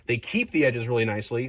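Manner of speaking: normal talking voice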